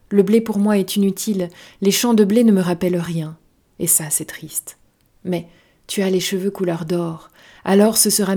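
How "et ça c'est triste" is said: soft, slow, touch of sadness; understated sadness. A narrator's reading. Fox confides in the little prince, softly sad. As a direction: let the voice sink quietly and slow down.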